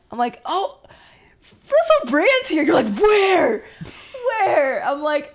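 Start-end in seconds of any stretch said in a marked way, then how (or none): 0.4-2.6 high, loud starstruck blurt; bursting to tell him he's here; excited reveal. Retold or imitated. Excited whisper-shout of celebrity. 3.0-4.8 loud, high, urgent repeat; frantic, looking all around; eager mock panic. Imitating husband's eager search.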